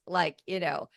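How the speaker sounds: ordinary talk, nothing marked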